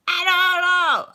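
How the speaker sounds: mock-helpless reply; sheepish shrug. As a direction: play innocent to mom. Answering mom about late hours.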